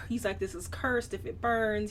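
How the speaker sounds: ordinary delivery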